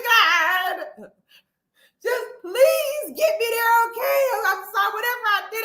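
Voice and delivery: high, pleading, half-crying promises to God; frantic prayer. Retold or imitated. Clutching the seat and praying to God, high, half-crying plea. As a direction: beg God for mercy, close to tears.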